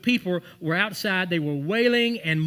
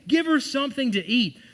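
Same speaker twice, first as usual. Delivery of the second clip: higher, louder repeat; preached command repeated; 8 semitones higher, 4 dB louder than usual. Retold or imitated. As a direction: hammer it home. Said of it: "That's what he said".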